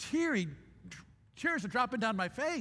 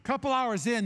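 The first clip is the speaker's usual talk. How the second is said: normal talking voice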